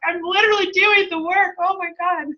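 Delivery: exasperated. Retold or imitated. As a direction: act out throwing up your hands at God. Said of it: "I was like".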